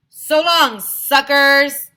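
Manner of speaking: gloating shout. A narrator's reading. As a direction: taunt them as you flee. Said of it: "Mayor Hubble shouted".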